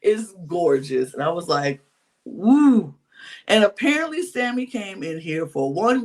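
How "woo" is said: higher, louder whoop; admiring woo. Retold or imitated. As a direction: let out an impressed "woo". Reacting to the gorgeous newcomer.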